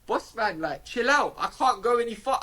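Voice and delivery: lightly voiced, high-pitched driver's protest; imitated protesting driver. Bus driver tells lad to chill out, imitated protest.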